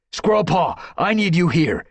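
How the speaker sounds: high, loud command. Acted. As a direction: call her back sharply. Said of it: "he commanded".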